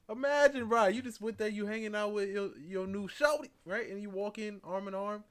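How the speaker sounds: high, loud, hyped setup; excited. Real, not acted. Streamer hyping a story to chat, loud and excited. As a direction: pump it up, bro energy.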